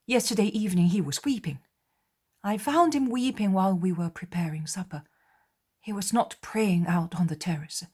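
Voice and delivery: John pleading with the others; worried apostle. Acted.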